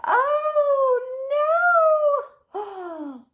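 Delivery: high gasping oh no; shocked gasp. Acted. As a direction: gasp in horror at the mess. Mama sees the mess.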